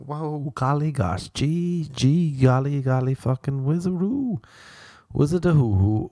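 high, hammed-up wholesome cheer; mock gee-whiz. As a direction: play an over-the-top innocent goody-goody.

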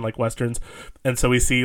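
usual speaking voice

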